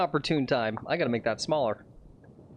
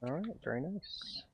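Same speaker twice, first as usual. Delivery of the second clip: whisper; 3 semitones higher, 11 dB quieter than usual. Real, not acted. Golf whisper on the course.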